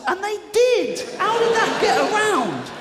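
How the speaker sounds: high, exclaimed comic disbelief; incredulous outburst. Acted. Climax of incredulous rant.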